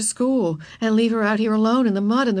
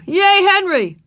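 high, loud cheering; yelled cheer; 12 semitones higher, 8 dB louder, slower than usual. A narrator's reading. Boys at window cheer the rescuer, yelling. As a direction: hoot it out to a hero. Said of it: "yelled some big boys".

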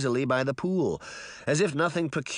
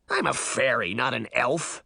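usual speaking voice

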